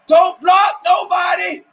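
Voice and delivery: yelling at the TV. Real, not acted. Fan yelling at Mike Evans through the TV, loud and slow. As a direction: drag it out and yell at the player.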